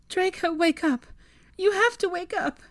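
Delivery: high, loud frantic shout. Acted. Hermione yells at unconscious Draco, frantic. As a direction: shake him awake with the voice.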